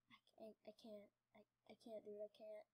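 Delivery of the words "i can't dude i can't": usual speaking voice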